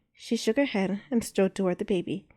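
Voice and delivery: ordinary delivery, nothing marked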